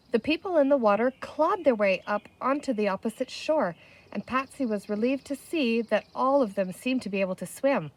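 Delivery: plain reading voice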